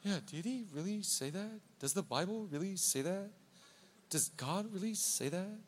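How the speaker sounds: soft, questioning undertone; doubting murmur. Acted. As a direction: let the doubt creep in quietly. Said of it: "you and I are like".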